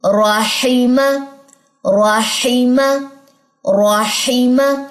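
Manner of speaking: राहिमा very slowly, heavy ra, thin ha; slow recitation drill. Shown as a demonstration. Teacher repeating the word for practice. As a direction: drag out राहिमा with a full ra. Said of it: "इसमें रा मोटा था".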